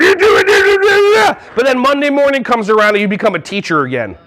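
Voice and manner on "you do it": high, drawn-out mock-drunk yelling; silly party voice. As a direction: let loose a tipsy put-on voice. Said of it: "do accents or voices with your friends".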